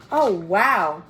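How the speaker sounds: plain reading voice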